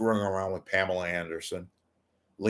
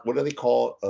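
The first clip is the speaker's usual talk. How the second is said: ordinary talk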